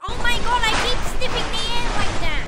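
loud startled cry at the screen; loud alarmed outburst. Real, not acted. Panicking at game character.

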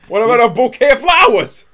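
high, loud put-on voice; mock bird voice. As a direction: whine it as the offended dead bird. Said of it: "Could you give a guy".

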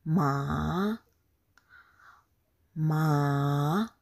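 "ma" drawn out low, then swept up; falling-rising tone. Shown as a demonstration. Teacher models second tone on "ma", falling-rising. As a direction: dip "ma" down and let it rise at the end. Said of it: "we are going for the second tone".

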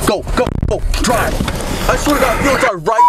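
high-pitched, urgent shouted orders; frantic yelling. Acted. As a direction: yell driving orders in a panic, voice way up. Driving test skit, urgent yelling.

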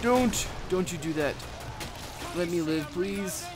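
high pleading cry; desperate pleading. Real, not acted. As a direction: beg for your life. Being killed in game.

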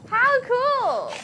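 high, loud delighted cry; excited. Real, not acted. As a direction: gush over the win. Celebrating the coconut.

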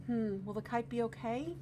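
usual speaking voice

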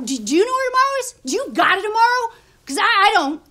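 loud, high-pitched demand; agitated, exasperated outburst; heated challenge. Acted. Heated challenge about having a tomorrow.

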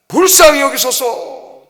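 loud, high, drawn-out plea. Acted. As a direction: call out for mercy with full voice. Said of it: "더 크게 외치는 거죠".

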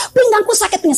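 high-pitched mock complaint of pain; tiruan putri mengeluh. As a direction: imitate a daughter wailing about pain. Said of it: "Putrinya juga begitu, dalam keadaan manifestasi". (tiruan mengaduh bernada tinggi)

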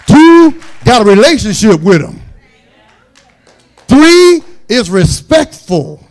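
high, loud pulpit cries on each number; shouted preaching. Acted. Preacher listing rules for his daughter's suitor, shouting. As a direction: call out the rules like a sermon peak.

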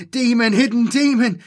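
high, loud, full shriek. A narrator's reading. Terrified Jomi accuses the robot, shrieking. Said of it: "he shrieked at it".

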